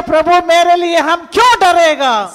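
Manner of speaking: loud, high shout; shouted declaration. Shown as a demonstration. Pastor urging the church to stand and shout, loud. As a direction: cry it out standing up. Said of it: "खड़ा होके चिल्ला के बोलो".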